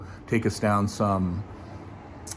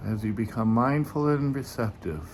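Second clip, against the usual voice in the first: guided meditation; 5 dB quieter than usual. Acted. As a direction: ease listeners gently into the breath. Leads a meditation.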